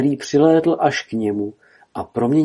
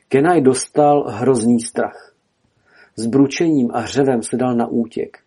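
ordinary delivery, nothing marked

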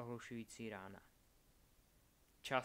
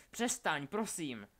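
loud, higher-pitched plea; pleading; 4 semitones higher, 6 dB louder, slower than usual. A narrator's reading. Albus begs Delphi to stop torturing his friend, urgent pleading. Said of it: "prosil Albus".

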